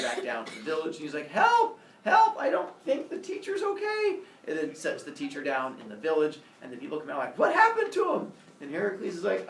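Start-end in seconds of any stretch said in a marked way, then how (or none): 1.3-4.2 very high, loud mock cries for help; hammed-up alarm, easing in volume; panicked call. Heracles runs to village for help. 7.4-8.3 high, loud shocked question; hammed-up horrified crowd; alarmed villagers. Villagers see the body.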